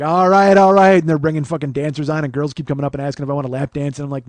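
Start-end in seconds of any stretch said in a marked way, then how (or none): 0.0-1.0 loud, higher, drawn-out DJ hype; strip-club DJ. Retold or imitated. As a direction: imitate a club DJ booming to the room. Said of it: "the fucking D Js are like".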